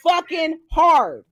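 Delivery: slow, drawn-out, hard-hit words; stretched emphasis. Real, not acted. Ranting to chat about the caller, slow heavy emphasis. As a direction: drag out each word to press it home.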